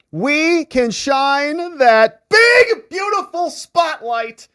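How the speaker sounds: very loud, high, drawn-out announcer shout; booming sign-off. Pretend. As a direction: belt out the catchphrase like a big show finale. Host booms his show sign-off.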